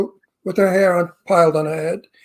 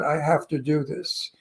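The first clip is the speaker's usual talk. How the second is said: softly imitating a courteous brush-off; low, hushed, polite excuse; lightly acted, gentle and apologetic dismissal; 4 semitones lower, 9 dB quieter than usual. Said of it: "she very politely said".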